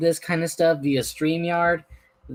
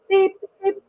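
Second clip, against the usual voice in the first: engine whistle beep; 3 dB louder than usual. Acted. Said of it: "said Henry".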